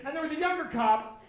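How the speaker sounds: ordinary talk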